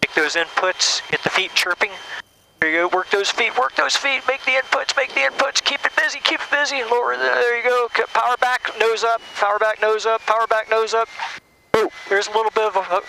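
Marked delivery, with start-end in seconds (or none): none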